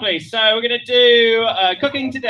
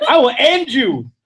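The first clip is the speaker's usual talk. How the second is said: drawn-out mock threat, raised pitch; 5 semitones higher, 4 dB louder, slower than usual. Comic warning the interrupting partner, mock threat. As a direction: threaten with laughter underneath.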